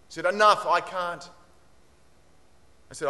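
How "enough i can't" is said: loud, high, slow cut-off; acted teacher putting a firm stop to excuses; stern and emphatic, raised voice; retold sharp reprimand. Teacher cutting off pupil's refusal.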